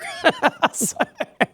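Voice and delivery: a high, real laugh bursting out; burst of laughter. Real, not acted. Coach laughing after retelling his blunt reply to Doc. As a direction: laugh at your own comeback.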